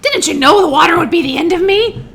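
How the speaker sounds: high, loud witch's wail; wailing, despairing. A narrator's reading. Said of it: "in a wailing, despairing voice".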